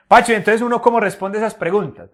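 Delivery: teacher voicing a student's question; student asking. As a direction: pipe up like an eager pupil with a question.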